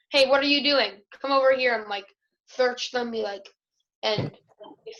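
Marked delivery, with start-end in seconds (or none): 0.1-1.7 put-on a sharp, louder shout at a kid; imitated cop yell. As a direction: call the kid over like a suspicious cop. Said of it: "they might yell".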